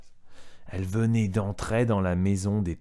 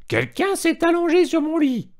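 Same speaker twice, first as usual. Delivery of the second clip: much higher, louder mama bear cry; mama bear's voice; 8 dB louder than usual. A narrator's reading. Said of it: "s'écria d'une voix moyenne". (voix de maman ours, très aiguë)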